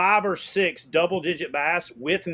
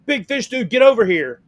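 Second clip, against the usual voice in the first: high then loud call across the lake; excited summons, louder at the end; 6 semitones higher, 6 dB louder than usual. Retold or imitated. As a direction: yell to your buddy to hurry over. Calling friend over across lake.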